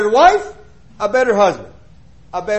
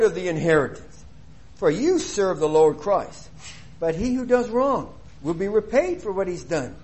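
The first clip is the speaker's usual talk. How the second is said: scripture reading; 4 semitones lower than usual. Acted. Preacher reads the last verse to the church, solemn and steady. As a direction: read the verse out, letting the end sink.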